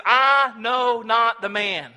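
a cornered man shouting his oath; swearing denial. Acted. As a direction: swear it out, furious that they won't drop it. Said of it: "he begins to swear".

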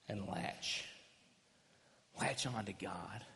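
hushed whisper of the key word; a sudden hushed whisper to the congregation. Acted. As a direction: lean in and breathe it out. Preacher drops to a whisper.

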